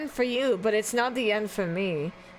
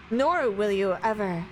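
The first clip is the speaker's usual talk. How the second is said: ordinary talk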